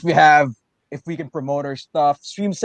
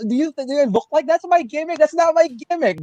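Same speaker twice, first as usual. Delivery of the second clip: high playful protest; 10 semitones higher than usual. Real, not acted. Brushing off the accent request, high mock-indignant. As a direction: pitch climbing, laughing off the idea.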